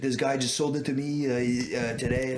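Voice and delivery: imitated Quebecois seller; Quebec accent. Seller explains where he got it, in Quebec accent. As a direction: stumble through it in a Quebec French accent. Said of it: "You've got a good Quebec accent".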